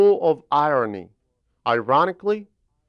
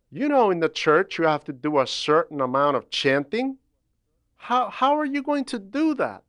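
a lightly raised, pleading father voice; father's worried warning; 5 semitones higher than usual. Retold or imitated. A father doubts his squeaky-voiced son can chant, lightly raised worried plea. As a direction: voice the father pressing his son, a little higher.

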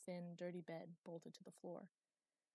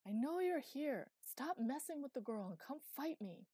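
loud, raised-pitch defiant shout; shouted challenge; 7 semitones higher, 8 dB louder than usual. A narrator's reading. Thunder daring Hallam to fight, shouting. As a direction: shout a dare at a hidden enemy. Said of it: "he shouted".

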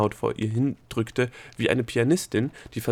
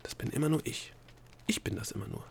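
hushed half-whisper, repeating to herself; whispered thought; 4 semitones higher, 7 dB quieter than usual. A narrator's reading. Delia repeats it to herself, hushed and half-whispered. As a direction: murmur it under the breath, trying it out. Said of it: "sagte sie sich in Gedanken".

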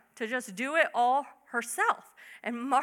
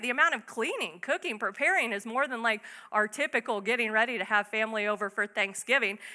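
ordinary talk, nothing marked

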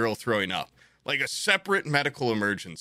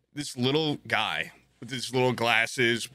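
ordinary delivery

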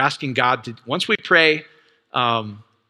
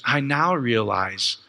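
slow realization; slower than usual. Retold or imitated. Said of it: "She goes".

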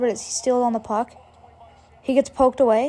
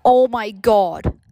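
excited; 5 dB louder, slower than usual. Real, not acted. Relief after goal.

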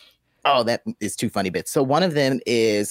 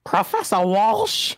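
incredulous dad; 9 semitones higher, slower than usual. Retold or imitated. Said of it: "that tone of voice".

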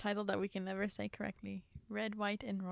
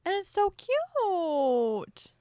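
slow, high gushing coo; 10 semitones higher, 7 dB louder, much slower than usual. Real, not acted. Gushing to co-host about a cute Doberman. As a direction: coo over the cute dog. Gushing over a flappy-eared dog.

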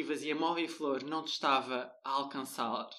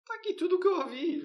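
mock announcement; faster than usual. Teasing friend, mock announcement.

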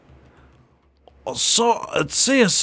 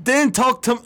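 hammed-up British accent, higher; mock British accent; 10 semitones higher than usual. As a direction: scold in an overdone British accent. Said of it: "I sound like a British fucking idiot now".

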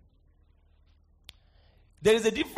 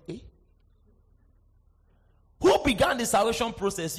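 normal talking voice

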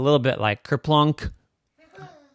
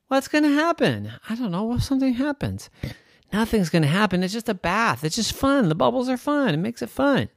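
put-on child voice; 9 semitones higher than usual.

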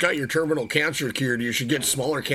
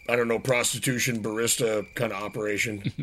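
ordinary talk

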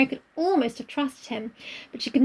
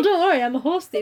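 normal talking voice

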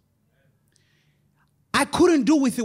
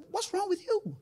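voicing God; 10 semitones higher, 6 dB quieter than usual. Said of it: "God is saying".

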